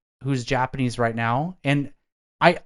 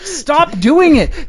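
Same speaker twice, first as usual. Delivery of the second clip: high, loud, slow exasperated yell; exasperated shout; 9 dB louder, much slower than usual. Acted. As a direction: yell it out, fed up. Venting about train riders.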